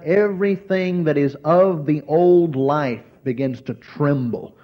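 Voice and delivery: normal talking voice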